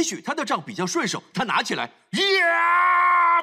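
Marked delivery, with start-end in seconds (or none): 1.8-3.4 loud high strike yell; a big heave as the staff comes down; effort yell striking. Retold or imitated. Acting Moses striking the rock. (击打磐石时大吼一声)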